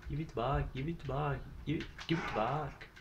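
lightly voiced outraged clerk, without yelling; mock screaming clerk. Gamer repeating his gas station gag, mock screaming. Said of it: "come running out with a shotgun screaming".

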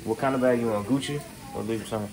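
usual speaking voice

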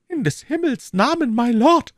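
very high, loud woman's cry; alarmed cry. A narrator's reading. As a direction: cry out to stop a fight. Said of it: "rief Madame Bonacieux".

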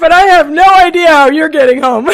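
loud, high words through real laughter; cracking up, pitch way up; laughing hard. Real, not acted. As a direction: struggle to finish the punchline while laughing. Finishes punchline through her laughter.

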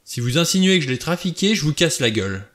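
rapid angry burst, pitch jumping up; furious outburst. A narrator's reading. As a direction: snap it out, beside yourself with anger. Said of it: "vociféra Blore hors de lui".

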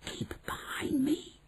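slow, hushed whisper; secretive unvoiced whisper; urgent whisper. A narrator's reading. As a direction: whisper it as you set off sneaking. Sneaking out past people who kill.

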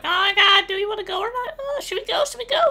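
high, squeaky mock-dithering voice; panicky kid quote. Gamer mimics siblings scared of the boss, squeaky mock panic. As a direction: imitate nervous kids in a tiny high voice. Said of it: "we were like".

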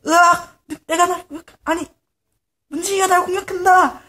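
high, loud frightened crying out; panicked yell. Acted. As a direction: yell while being attacked. Pigman bitten, screams.

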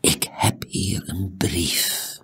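slow, secretive whisper; breathy confidential hush. A narrator's reading. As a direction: whisper it very slowly.

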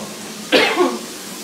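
short harsh cough from spicy heat; cough, throat burning. Real, not acted. Coughing after eating peppers.

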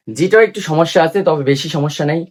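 ordinary delivery

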